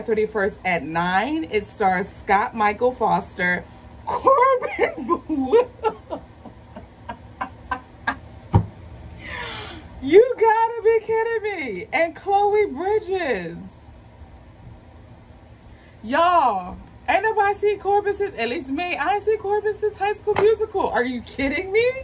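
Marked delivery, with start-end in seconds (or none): 4.0-13.6 high, laughing squeal at the name, slow; excited squeal. Real, not acted. Fan sees star's name. 16.0-21.9 thrilled. Real, not acted. Thrilled about Corbin casting.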